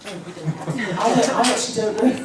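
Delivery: ordinary delivery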